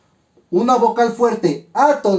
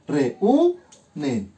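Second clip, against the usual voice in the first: teacher spacing out "Re, u, nen"; split into syllables; much slower than usual. Shown as a demonstration. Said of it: "hacer la división silábica".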